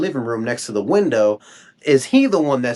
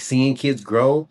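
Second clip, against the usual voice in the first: plain reading voice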